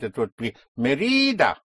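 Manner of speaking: Mérida stressed on the middle syllable, me-RI-da; Mérida as it would sound without its accent, me-RI-da; wrongly placed stress. Shown as a demonstration. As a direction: stretch and lift the RI in Mérida. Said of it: "Without the accent".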